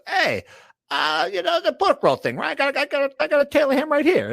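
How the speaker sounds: high, thick Jersey mobster voice; Paulie Walnuts impression. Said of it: "talking like Paulie Walnuts".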